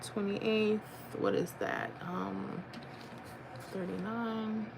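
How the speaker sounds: very soft, half-voiced murmur; muttering to self. Real, not acted. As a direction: think aloud under your breath. Reads figures to self while looking.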